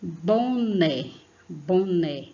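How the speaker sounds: "Boné" with the second syllable pushed harder; teacher demonstrating the strong final syllable of "boné"; stress on last syllable. Shown as a demonstration. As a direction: lean firmly on the "né" of "Boné". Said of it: "fala com mais força".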